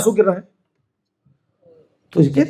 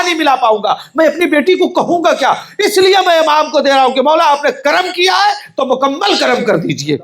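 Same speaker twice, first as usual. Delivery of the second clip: higher, pleading sermon voice; impassioned preaching; 6 semitones higher than usual. Acted. Preacher voicing the father begging the Imam, higher pleading. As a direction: plead with the Imam through the crowd.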